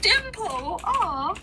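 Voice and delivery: surprised. Real, not acted. Player reacts to pixie name.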